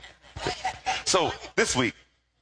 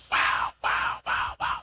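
breathy wow; slower than usual. Real, not acted. Preacher marvels at reversal.

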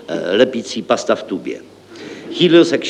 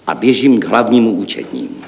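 ordinary delivery

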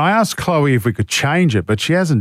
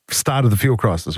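ordinary talk, nothing marked

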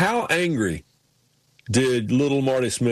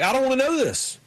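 much higher-pitched protest; high-pitched refusal, acted out; retold protest; 11 semitones higher than usual. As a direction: blurt a high, pleading objection. Acting out his protest to film guys.

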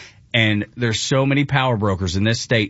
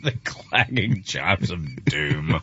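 low, soft, slow grand echo; grand mimicry; 6 semitones lower, 5 dB quieter, much slower than usual. Co-host relishes the quoted line, low grand mimicry. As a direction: intone it slowly and grandly, pitched down. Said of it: "That's so grand".

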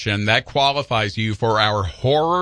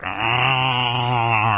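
zombie groan; 2 semitones lower than usual. Shown as a demonstration. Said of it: "so you could be like".

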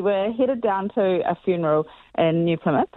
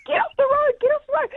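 far higher, retold shriek of panic; screaming; faster than usual. Screaming at her friend to get off the road, much higher. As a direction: scream it like the truck is right there. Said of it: "I'm screaming at her".

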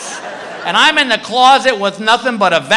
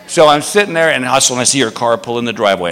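ordinary talk, nothing marked

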